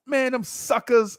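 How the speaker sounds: put-on high, slow whiny kid complaint; pouting kid complaining. Kid complaining to the neighbor, high slow sulk. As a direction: sulk it out like a cheated boy. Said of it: "And I'm like".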